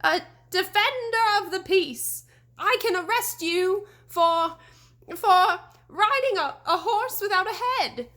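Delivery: high, loud, quavering bluster; shouted, mustering courage. A narrator's reading. Scared deputy trying to threaten the ghost, high shaky shout. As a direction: puff up a frightened voice to sound brave. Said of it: "Crane shouted, trying to muster all his courage".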